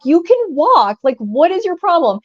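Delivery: fed up, asking what her problem is; exasperated retort. Retold or imitated. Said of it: "I'm like".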